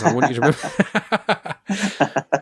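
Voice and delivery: laughing teasing reminder. Real, not acted. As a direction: tease him through your own giggles. Teasing Frank while laughing.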